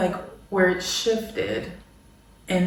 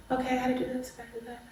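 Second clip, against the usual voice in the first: soft, fading mental to-do list; calmer thoughts; 5 semitones higher, 11 dB quieter, much faster than usual. Retold or imitated. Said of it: "It becomes very".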